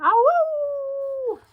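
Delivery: very high, loud, long howl; wolf howl. Shown as a demonstration. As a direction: show the kids how a wolf howls. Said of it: "do it again".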